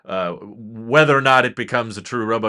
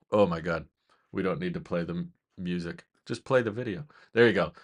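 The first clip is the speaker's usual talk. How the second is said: low, soft grumble to oneself; muttered aside; 7 semitones lower, 8 dB quieter, faster than usual. Real, not acted. Host fussing with the video playback, muttered aside. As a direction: mutter at the gadget under your breath.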